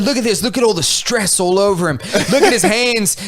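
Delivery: crocodile hunter. Retold or imitated. Crocodile hunter character showing the catch to camera, mock Australian. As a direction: show off the specimen in mock Aussie. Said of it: "just like the crocodile hunter".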